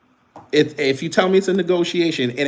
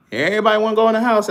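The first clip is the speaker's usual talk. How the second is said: higher, louder, fast mocking repeat; raised teasing jab at the chat; mocking taunt; 7 semitones higher, 4 dB louder, much faster than usual. Real, not acted. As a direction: rub it in and call them out.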